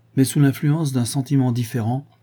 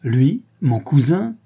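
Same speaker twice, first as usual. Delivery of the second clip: slow, higher, louder incredulous question; scornful exclamation; 5 semitones higher, 5 dB louder, slower than usual. A narrator's reading. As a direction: draw it out with disdain. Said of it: "s'écria Cathy avec un rire méprisant". (exclamation méprisante et incrédule)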